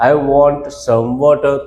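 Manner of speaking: very slow, every word laid out for the class; slow model sentence. Shown as a demonstration. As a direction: say it slowly like a teacher modelling English. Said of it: "તો આપણે શું કહેવાય?".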